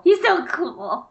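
high, slow swooning gush; dreamy mock swoon on "He's so cool"; gushing fangirl. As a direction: swoon over the word cool.

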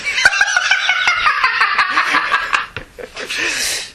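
high-pitched burst of real laughter; long laugh. Real, not acted. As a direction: burst out laughing and keep it going. Laughing at own punchline.

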